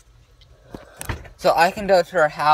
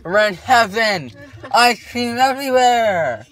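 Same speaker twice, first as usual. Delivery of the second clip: loud gleeful cry; excited shout; 6 semitones higher, 6 dB louder than usual. Real, not acted. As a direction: yell it out in delight. Kids delighted at spill.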